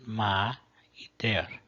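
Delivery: "ma e ter" said slowly, each syllable separated; syllables split apart. Shown as a demonstration. Teacher splitting a Latin word into syllables, slow. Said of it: "tem duas sílabas".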